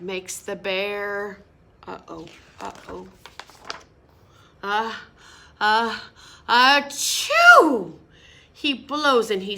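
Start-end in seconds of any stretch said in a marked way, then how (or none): 1.9-7.9 building sneeze. Acted. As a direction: wind up a big sneeze then blast it.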